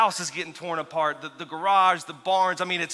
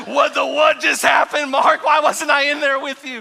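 much higher, louder indignant yell as the dog; shouting dog voice; 8 semitones higher, 10 dB louder than usual. Retold or imitated. Preacher voices his dog demanding why he was left out, loud shout. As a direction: bellow it like a shocked, offended dog.

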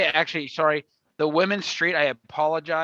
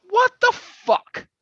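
much higher, slow, squawked outrage; outraged disbelief; 4 dB louder, slower than usual. Acted. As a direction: shriek it in mock disbelief. Peak of outraged rant.

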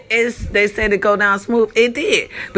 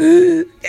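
sharp, loud gasp for air; mimed struggle for breath; imitated choking gasp; 8 semitones higher, 5 dB louder than usual.

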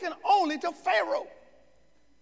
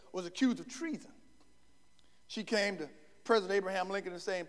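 usual speaking voice

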